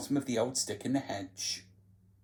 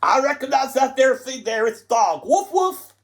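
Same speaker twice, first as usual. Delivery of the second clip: triumphant Tatty Bogle; 10 semitones higher, 8 dB louder than usual. Acted. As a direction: crow about coming back.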